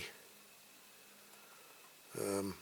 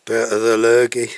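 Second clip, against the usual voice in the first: sniffly, with a cold; talking through sniffles; quick sniff; 9 dB louder than usual. Real, not acted. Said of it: "excuse the sniffing".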